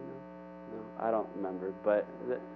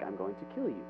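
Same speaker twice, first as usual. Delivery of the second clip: low, quiet threat; a hint of a fierce queen, voice dropped; 5 semitones lower, 10 dB quieter than usual. Fierce queen threatening Elijah, mock quiet menace. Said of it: "a fierce lady, and she's like".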